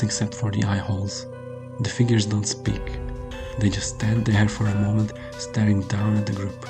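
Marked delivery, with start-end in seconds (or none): none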